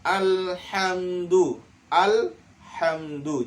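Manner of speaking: "Alhamdu." slow with the ha held; held reading. Shown as a demonstration. Teacher models the held ha for the class. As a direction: hold the ha in "Alhamdu." slowly.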